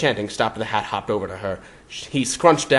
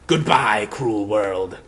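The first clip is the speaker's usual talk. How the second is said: intoning; much slower than usual. A narrator's reading. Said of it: "the Hat intoned".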